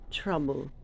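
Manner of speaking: ordinary delivery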